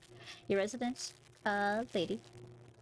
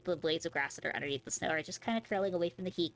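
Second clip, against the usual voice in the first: normal talking voice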